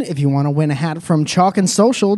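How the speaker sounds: ordinary delivery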